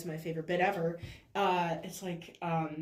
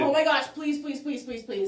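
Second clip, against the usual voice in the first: loud, high gushing plea; real fangirl begging, starting loud and easing off; excited pleading; 7 semitones higher, 6 dB louder than usual. Real, not acted. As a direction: beg eagerly, words tumbling in a row. Begging to discuss the show.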